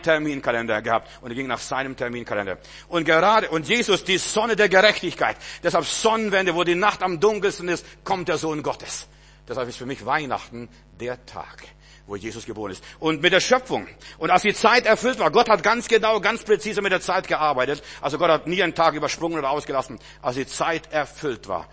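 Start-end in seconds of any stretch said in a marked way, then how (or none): 2.9-13.9 loud, high preacher's proclamation; fervent sermon shout; full-force preaching. Acted. 14.2-15.3 proclaimed loudly. Shown as a demonstration. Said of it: "repeated scripture line". 15.3-20.0 loud, high preacher's proclamation; fervent sermon shout; full-force preaching. Acted. 20.2-21.7 slow solemn reading. Shown as a demonstration. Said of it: "repeated scripture line".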